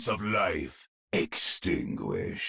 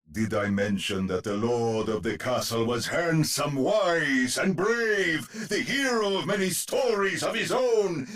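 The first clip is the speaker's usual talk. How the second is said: climbing higher and higher with pride; excited boasting; 8 semitones higher than usual. Acted. Narrator's boastful digression about lord.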